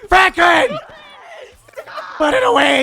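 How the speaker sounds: shrieking and sobbing, high and loud; crying shout. Acted. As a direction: scream through tears to stop him. Begging officer to drop gun.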